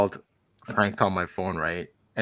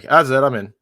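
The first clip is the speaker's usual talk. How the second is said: loud, higher burst of enthusiasm; excited reaction; 4 semitones higher, 9 dB louder than usual. Retold or imitated. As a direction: blurt it out, instantly sold. Acted reaction to the show.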